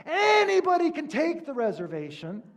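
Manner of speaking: a final indignant dig at the clerk; agitated Seinfeld. Retold or imitated. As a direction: Seinfeld jabbing at the rental clerk. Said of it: "And then he goes".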